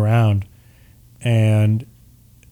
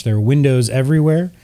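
normal talking voice

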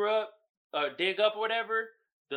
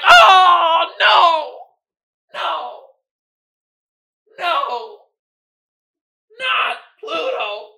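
mock pleading shout; 10 semitones higher, 14 dB louder, much slower than usual.

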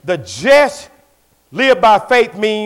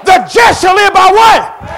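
louder, higher slow shouted repeat of the question; preaching call-out; 11 semitones higher, 9 dB louder, slower than usual. Acted. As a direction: hammer it again so they answer.

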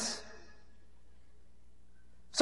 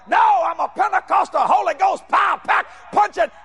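high-pitched, punched declaration; proud shouted preacher boast; fiery proclamation; 7 semitones higher than usual. Retold or imitated. Proclaims himself a Pentecostal preacher.

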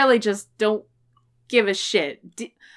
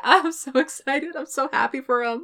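laughing, higher-pitched delight; excited and happy; 5 semitones higher, 4 dB quieter than usual. Real, not acted. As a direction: gush happily through a little laugh.

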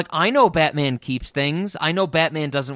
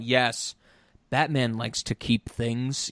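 flat monotone assistant voice; emotionless robot; 7 semitones lower, 4 dB quieter, slower than usual. Retold or imitated. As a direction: deliver it dead flat, like a robot. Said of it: "the monotone voice of the assistant going".

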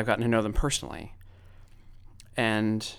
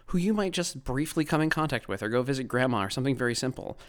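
ordinary delivery

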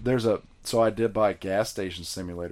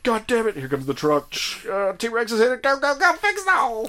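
high, loud frantic yelling; 14 semitones higher, 5 dB louder than usual. Retold or imitated. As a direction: hurry the crew in panic. Frantic play in the park game.

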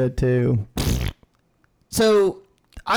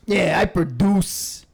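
Trump impression; 4 semitones higher, slower than usual.

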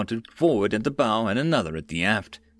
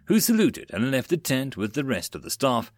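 plain reading voice

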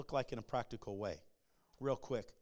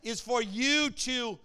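raised preacher's voice, leaning hard on key words; forceful preaching; 7 semitones higher, 9 dB louder than usual. Acted. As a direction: drive the message home to the congregation.

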